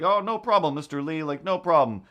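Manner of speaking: a gushing, accommodating host; eager assistant. Retold or imitated. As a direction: rush to reassure the star.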